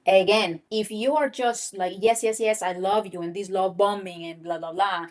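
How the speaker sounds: ordinary delivery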